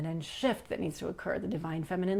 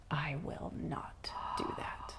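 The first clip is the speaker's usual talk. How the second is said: slow, low whispered refusal; hushed refusal; 4 semitones lower, 11 dB quieter, slower than usual. Retold or imitated. Man refuses the demand to kneel, slow hushed refusal. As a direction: whisper it slowly and firmly. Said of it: "he looks at me and says".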